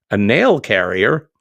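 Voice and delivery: loud, slow, teasing disbelief; amused surprise. Real, not acted. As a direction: repeat the word as if it can't be right. Host catches a funny typo.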